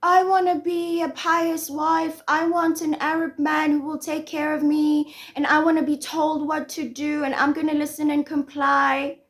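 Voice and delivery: high, level recital of wishes; mock submissive wife. She recites her verbiage about being a pious wife, mock-earnest. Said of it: "she had the verbiage of".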